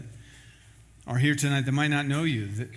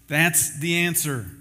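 ordinary talk, nothing marked

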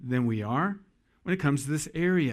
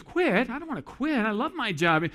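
high-pitched indignant wife imitation; mock-offended, high-voiced protest; 8 semitones higher than usual. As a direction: act out her dismayed objection, voice pitched way up. Acting wife's protest to husband.

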